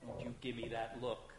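quiet threat from a mother; stern mom warning. Retold or imitated. Mother scolding her child for a sullen look, quietly. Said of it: "you ever hear mom say".

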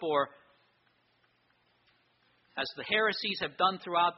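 earnest, rising pulpit prayer; fervent prayer. Acted. Pastor praying over his church, fervent and earnest. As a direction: pray aloud for the congregation.

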